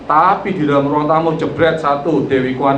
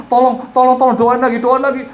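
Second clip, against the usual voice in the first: high, louder mock pleading; imitated tearful plea; 9 semitones higher, 4 dB louder than usual. Retelling someone begging for more prayer, imitated tearful plea. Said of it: "sampai nangis-nangis".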